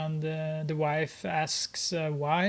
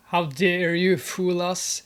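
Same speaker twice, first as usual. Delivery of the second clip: angry gangster; 5 dB louder than usual. Acted. Gangster scolding Grace.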